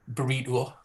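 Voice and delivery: "burrito" ending in a broad oo-uh; Geordie double vowels. Shown as a demonstration. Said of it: "It's a double vowels".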